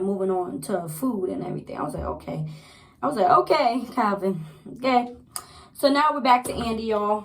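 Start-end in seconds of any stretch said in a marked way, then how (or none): 2.0-2.5 low, subdued "okay"; understated, softly dropped reaction; unimpressed okay. Retold or imitated. Reacting to sudden food talk. 3.3-5.1 pointed, raised reaction to Calvin; emphatic mock okay. Repeats reaction, now with emphasis.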